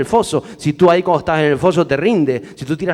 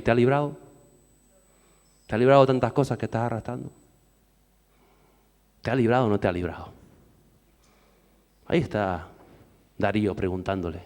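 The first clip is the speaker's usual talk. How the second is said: low, quiet searching questions; subdued, probing sermon delivery; hushed preaching; 5 semitones lower, 5 dB quieter, faster than usual. Acted. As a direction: ask it softly and low, leaving it to sink in. Preacher drops to a hush.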